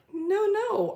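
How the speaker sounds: high, loud insistent no no; retelling a frantic denial, high and loud; emphatic no. Retold or imitated. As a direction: cut him off fast, half laughing. Insisting to the tech guy.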